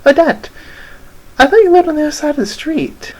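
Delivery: put-on very high, little-girl voice; tiny girl. Tiny girl greeting Odette at the door, high girlish voice. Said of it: "The tiny girl tips her head".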